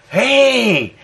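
usual speaking voice

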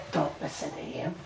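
hushed, tempting whisper in the ear; sly whispered temptation; devil's whisper. Acted. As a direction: whisper like the devil luring her away. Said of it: "the devil whispers in their ear".

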